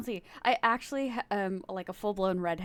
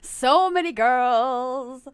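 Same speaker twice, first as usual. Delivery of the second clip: loud, higher, drawn-out gush; hammed-up boast; 4 semitones higher, 10 dB louder, much slower than usual. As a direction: stretch every word out loud and slow.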